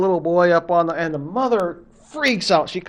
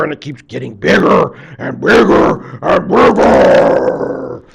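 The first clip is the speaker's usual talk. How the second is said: ordinary delivery, nothing marked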